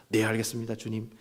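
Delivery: soft, low humble yes; obedient reply. Acted. Samuel humbly answers God, soft obedient voice. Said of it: "어떻게 대답해야 되겠습니까".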